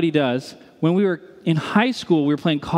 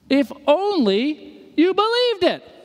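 slow, very high exclamation; exasperated, pitch soaring; impassioned plea; 12 semitones higher, slower than usual. Real, not acted. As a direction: draw out each phrase high, pleading. Exhorting the sleeping giant.

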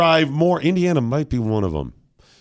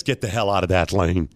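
stern officer; 7 semitones lower, 4 dB quieter than usual. Retold or imitated. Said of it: "steps up and says".

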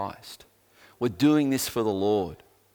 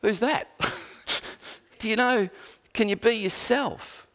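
much higher, baffled mock question; mock puzzled question; 10 semitones higher than usual. Told to be himself, a listener asks in mock bewilderment. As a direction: play it as a bewildered nobody asking who that is. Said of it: "are you gonna go".

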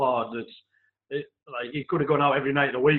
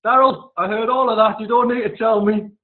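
higher and loud, dry reply up the stairs; mock manager call; 7 semitones higher, 6 dB louder than usual.

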